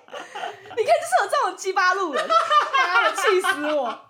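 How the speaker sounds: high, loud cursing through laughter; laughing fit. Real, not acted. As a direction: laugh while venting. 边笑边骂. (笑到骂人)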